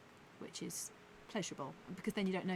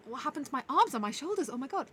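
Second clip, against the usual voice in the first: higher, louder aching dismay; morning-after shock; 8 semitones higher, 7 dB louder than usual. Retold or imitated. Waking up sore the next morning, shocked. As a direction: wake up stiff and alarmed. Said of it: "I'm like".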